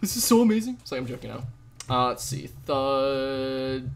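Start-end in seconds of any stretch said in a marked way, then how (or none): 0.0-0.8 high, loud mock excitement; a teasing overexcited squeal; mock gushing. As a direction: gush over it, tongue in cheek. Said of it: "I'm like".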